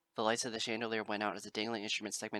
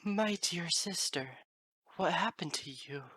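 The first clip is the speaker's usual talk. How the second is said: grieving; 8 semitones higher, 3 dB louder, slower than usual. A narrator's reading. Celestia grieving for her sister.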